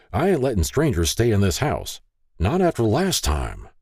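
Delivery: usual speaking voice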